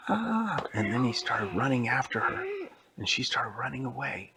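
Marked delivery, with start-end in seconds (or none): 0.1-0.6 mock scream. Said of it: "she was like".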